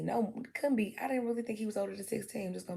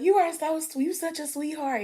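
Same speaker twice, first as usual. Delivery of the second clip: much higher, louder gushing; gushing compliment; 9 semitones higher, 7 dB louder than usual. Pretend. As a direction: gush over a sweetheart. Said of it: "they be like".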